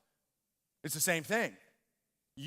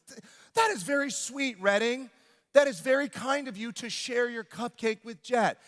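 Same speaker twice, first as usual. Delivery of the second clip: higher-pitched, gushing praise; praising parent; 5 semitones higher than usual. Retold or imitated. Dad praises daughter for sharing, gushing. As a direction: praise a small child sweetly.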